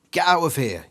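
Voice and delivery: high, loud yell. Acted. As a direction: yell at the intruder. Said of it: "you yell".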